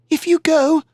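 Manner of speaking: high, pleading fear for her love; afraid. Acted. Iztaccíhuatl begging Popocatépetl not to leave, afraid. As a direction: beg him not to go, frightened. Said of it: "she said".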